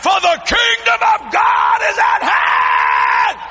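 fiery street-preacher shout; shouted preaching. Retold or imitated. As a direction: call the diners to repent. Preaching aloud in Denny's.

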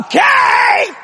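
very high, sing-out reply; imitated wife hollering back. Wife upstairs answers husband's instructions, imitated high holler.

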